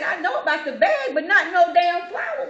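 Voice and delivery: high, loud, laughing indignant retort; mock indignant. Retelling a stranger's outburst at the store, mock indignant. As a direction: play up her outrage, half laughing.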